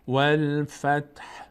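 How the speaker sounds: slow "والفتح", no vowel after t or h; pausal ending. Shown as a demonstration. Teacher models stopping at verse end, final h a mere breath. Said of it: "در هنگام وقف".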